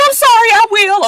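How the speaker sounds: put-on high, loud little-girl apology; high sugary fake sorry; meek fake sorry. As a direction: play it innocent and small. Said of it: "Nessa was just like".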